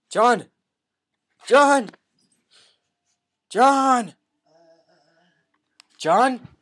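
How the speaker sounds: loud calls for a lost friend; calling out. Acted. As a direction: call John across the room. Calling out for John.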